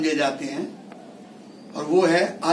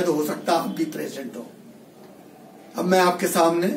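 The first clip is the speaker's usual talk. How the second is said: ordinary talk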